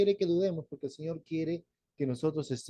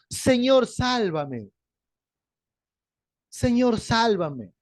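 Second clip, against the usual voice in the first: crying out for rescue; 4 semitones higher, much slower than usual. Acted. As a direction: call out like a man going under. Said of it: "y gritó".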